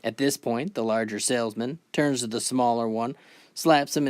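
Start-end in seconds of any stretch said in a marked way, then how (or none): none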